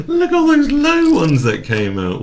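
high, loud, thrilled outburst; excited, high-pitched disbelief; elated amazement. Real, not acted. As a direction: marvel loudly at your luck. Amazed at the winning deal.

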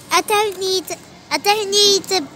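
ordinary talk, nothing marked

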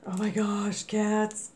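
normal talking voice

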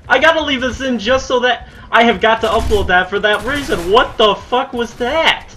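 loud, high-pitched incredulous yelling; outraged disbelief. Real, not acted. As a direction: yell it in stunned outrage at the game. Outraged at something in the game.

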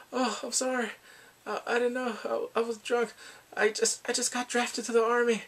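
high-pitched pleading excuse; acted frantic apology. Man excusing himself before a beating, high and pleading.

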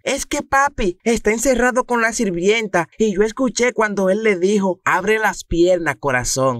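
put-on high little boy telling a secret; child voice. Niño cuenta lo que oyó. (voz de niño, mucho más aguda)

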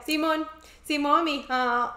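lilting Mexican "Simón, Simón, mija"; a light Mexican accent attempt; imitating a sing-song Mexican accent. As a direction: sing it, bouncy and musical. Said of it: "es un acento como cantadito".

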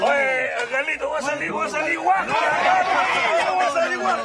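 high shouted joke; shouting playfully to Angelito; loud shout. Real, not acted. Shouting to cameraman.

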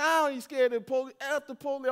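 imitating his younger defiant self; defiant brag. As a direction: toss it off like a reckless young man. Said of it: "You be like".

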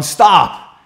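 loud, high shout all through; yelling. Retold or imitated. Yelling after the frightened woman running in the bush, loud high shout. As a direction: shout it after her, pleading but loud. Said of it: "I'm yelling".

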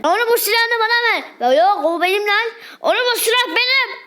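grab it back, indignant; heated squabble. Acted. Squabble over the gun.

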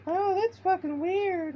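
very high, slow falsetto mom; mom's voice. Retold or imitated. Mom reacting in a mock high falsetto. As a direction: squeal it like a shocked mom. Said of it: "She's like".

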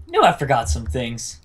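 normal talking voice

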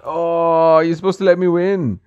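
ordinary talk

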